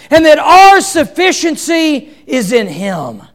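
loud, high preaching, slow on "trials"; preaching at full force. Real, not acted. Pastor drives home the point about trials, preaching loud. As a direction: preach it out over the congregation.

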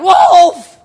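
high, loud cry for help; imitating the boy's real panicked scream; desperate cry. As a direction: yell it for real this time, the wolf is here. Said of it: "And he cried".

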